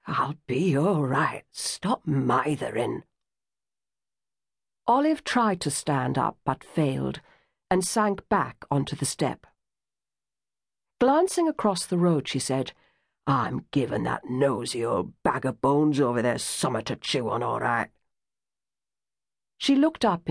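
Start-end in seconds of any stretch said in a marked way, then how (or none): 0.1-3.0 put-on lower, slow, weary older woman; faint mother waving off fuss, lower and slow; shaky Olive. A narrator's reading. Weak Olive after fainting. 13.3-17.9 put-on lower, sour older woman; grumbling Olive. A narrator's reading.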